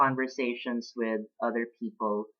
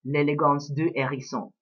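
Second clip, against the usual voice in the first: "L'Élégance du Hérisson" said with a careful French accent. Shown as a demonstration. To French-speaking viewers, the book title in a French accent. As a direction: give the title its French sounds, as learned in school. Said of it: "if my accent were bad".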